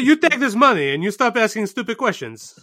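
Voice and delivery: high, laughing fake Russian accent; a giggly put-on Russian tycoon; mock Russian oligarch.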